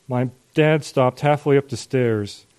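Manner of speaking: plain reading voice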